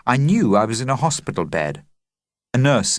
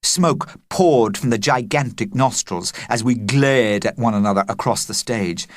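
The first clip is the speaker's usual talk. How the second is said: usual speaking voice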